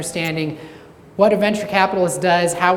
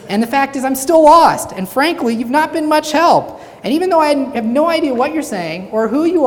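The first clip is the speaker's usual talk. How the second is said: higher, louder mock exasperation; exasperated; 5 semitones higher, 4 dB louder than usual. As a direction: vent at her, fed up. Exasperated balloonist complains.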